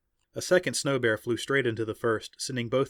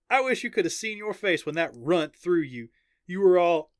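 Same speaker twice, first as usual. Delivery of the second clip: teasing friend; 7 semitones higher, 5 dB louder than usual. Acted. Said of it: "pretending to scream".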